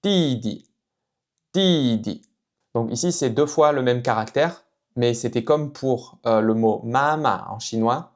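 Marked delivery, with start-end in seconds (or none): 0.0-2.2 ti then short neutral di; Mandarin didi. Shown as a demonstration. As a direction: ti falling, di light and short. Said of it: "on va dire". (ti di, second syllabe neutre et brève)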